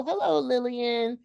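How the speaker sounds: high, gushing greeting; sweet Southern belle. Retold or imitated. Whitley greeting Rudy at the tea, high and gushing. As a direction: coo it like a beauty queen. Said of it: "And she says".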